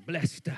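"blessed" with a church drawl and a lift; churchy blessed. Retold or imitated. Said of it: "If you grew up in church".

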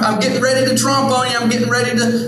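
high, hammed-up cheery villain announcing himself; mock Satan. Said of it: "Satan doesn't just come in".

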